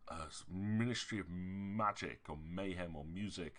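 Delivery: ordinary talk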